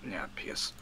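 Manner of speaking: very soft, low muttered aside; hushed, low and almost to yourself; quiet. Real, not acted. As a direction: say it under your breath, barely voiced. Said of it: "no need to scream".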